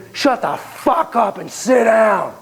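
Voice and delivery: high, drawn-out enraged command; acted furious outburst; angry shouted order. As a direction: shout him down, stretching the words. Stepdad climbs up, explodes at therapist.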